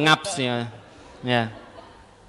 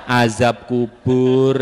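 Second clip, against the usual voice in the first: slow, low, drawn-out doom; mock-ominous preaching; 6 semitones lower, 4 dB louder, much slower than usual. Shown as a demonstration. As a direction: intone it like a grave warning, jokingly. Mimics grim topic for older audiences. (nada ceramah seram dibuat-buat)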